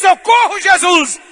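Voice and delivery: high, loud cry; loud cry for help. Retold or imitated. Drowning boy calling on Jesus, loud cry. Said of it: "mas quando ele disse".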